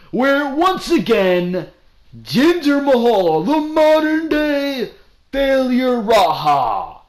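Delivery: jeering at the fallen champ; hammed-up scornful announcement; mocking shout. Real, not acted. Mocking Jinder Mahal's title.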